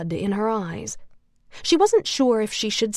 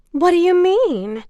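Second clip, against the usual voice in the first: phony concern; 9 semitones higher, 6 dB louder than usual. A narrator's reading. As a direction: fake sweet worry, pitched up. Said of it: "Nicole said with phony concern".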